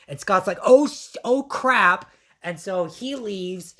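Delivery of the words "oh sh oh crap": high, loud, startled blurt; acted-out shocked swearing, cut short; alarmed reaction. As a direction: blurt it out in mock panic. Scott reacts to news of labor.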